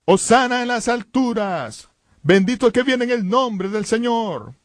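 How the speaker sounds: high, drawn-out cry of praise; grito imitado. Retold or imitated. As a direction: proclaim it like cheering disciples, pitched well up. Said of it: "sus discípulos empiezan a gritar". (aclamación alta y prolongada)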